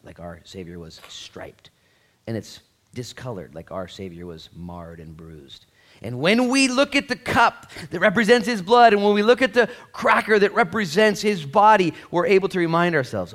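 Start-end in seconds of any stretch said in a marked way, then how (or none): 0.0-1.7 slow hushed whisper. Real, not acted. Pastor to congregation, a slow reverent whisper. Solemn communion description. 6.0-13.3 higher and louder, preaching hard; full-force preaching. Real, not acted. Pastor rousing the church, full-force preaching.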